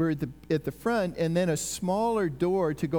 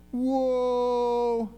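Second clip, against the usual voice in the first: long drawn-out, higher-pitched whoa; awestruck amazement; 6 semitones higher than usual. Acted. Witnesses seeing the empty grave cloths, drawn-out amazement. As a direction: hold one astonished note, as if stunned.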